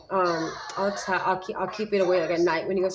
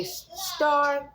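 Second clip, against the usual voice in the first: "star" popped much higher; high bright prompt; 8 semitones higher than usual. Shown as a demonstration. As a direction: cue the last word high and bright. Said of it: "and then I'll say".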